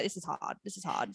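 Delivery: quiet, deflated half-moan; soft defeated sigh. Retold or imitated. Missing her partner while crying alone, quiet deflated moan. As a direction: let it sink, soft and worn out.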